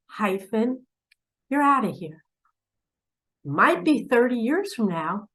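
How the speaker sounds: ordinary talk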